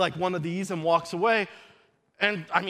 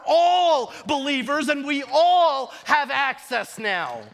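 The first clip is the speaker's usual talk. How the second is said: raised-pitch preaching, stretched key words; impassioned preaching; 5 semitones higher than usual. Real, not acted. Preacher telling believers they all have access now, impassioned. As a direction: build to a triumphant proclamation.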